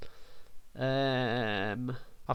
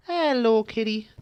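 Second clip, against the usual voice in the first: sweet greeting to cat; 9 semitones higher, 4 dB louder, slower than usual. Real, not acted.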